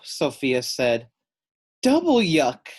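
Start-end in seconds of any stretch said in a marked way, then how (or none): 1.8-2.7 high, loud "Yuck"; hammed-up kid disgust. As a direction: recoil from smelly fish. Sophia echoes the disgust.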